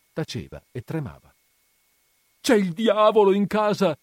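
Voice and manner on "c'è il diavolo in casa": high, loud frightened cry; panting alarm. A narrator's reading. Menico panting out a warning to Renzo, frightened cry. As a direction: blurt out the terrible news. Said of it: "riprese Menico ansante".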